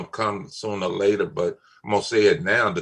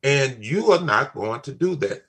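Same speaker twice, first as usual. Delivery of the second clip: quick, raised, insistent retelling; firm retold warning; 7 semitones higher than usual. Father to girlfriend about his children, insistent warning. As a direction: press each "I mean it" home.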